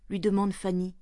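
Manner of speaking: usual speaking voice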